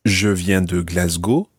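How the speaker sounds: Glasgow said with a French accent. Shown as a demonstration. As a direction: give Glasgow a French pronunciation, rising at the end. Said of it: "try to say them in a French accent".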